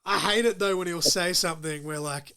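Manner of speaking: ordinary delivery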